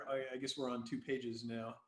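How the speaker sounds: usual speaking voice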